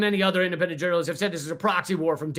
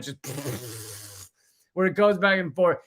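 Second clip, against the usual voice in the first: ordinary delivery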